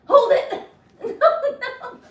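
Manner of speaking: high, giggly panic as balance goes; real laughter breaking up the words; laughing while toppling. Real, not acted. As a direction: squeal it through giggles mid-wobble. Losing balance in a handstand.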